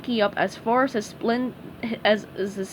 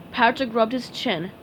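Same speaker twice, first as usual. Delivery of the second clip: plain reading voice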